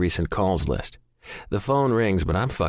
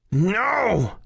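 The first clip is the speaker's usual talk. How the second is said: much higher anguished scream; 14 semitones higher than usual. A narrator's reading. Man screaming at the phone going dead, anguished scream. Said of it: "I scream".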